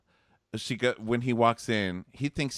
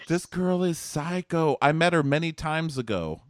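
mock defensive protest; 7 semitones higher, 3 dB louder than usual. Acts out man's defensive protest.

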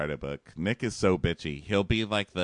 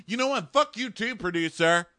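much higher bro outburst; mock bro rant; 11 semitones higher than usual. Host imitating Josh cursing the producer, high bro voice. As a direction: tell him off like a sulking bro.

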